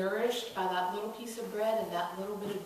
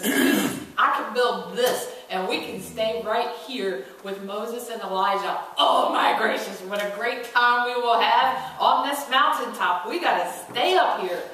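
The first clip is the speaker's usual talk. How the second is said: much louder, eager sales pitch; excited Peter; 10 dB louder than usual. Acted. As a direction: gush about the plan.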